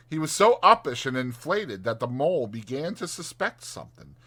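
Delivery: usual speaking voice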